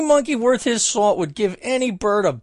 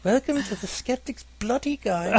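a light put-on British accent, done by the man who introduces the podcast; sultry British podcast-intro voice, a hint softer; British woman voice; 5 dB quieter than usual. Shown as a demonstration. Host replays his podcast intro in a British accent. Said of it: "I do a voice".

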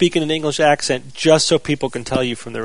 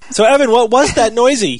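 excited call; 10 semitones higher, 8 dB louder than usual. Real, not acted. Host calls for the reveal.

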